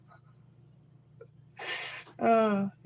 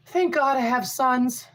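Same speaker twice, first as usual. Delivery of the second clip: ordinary talk, nothing marked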